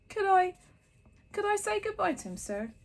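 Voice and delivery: high, pleading giant voice; tearful big giant begging. A narrator's reading. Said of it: "asked Hagrid".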